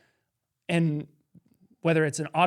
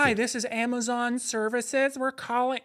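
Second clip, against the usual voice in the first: higher, slow fake call-center greeting; imitating scam caller; 6 semitones higher than usual.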